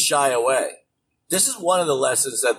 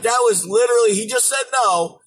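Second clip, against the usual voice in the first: ordinary talk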